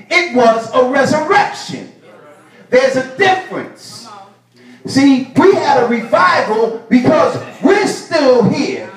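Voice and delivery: high, loud, whooping preacher cadence; preaching at full force. Acted. Preacher rousing the congregation, loud and high. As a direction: drive it home to the church.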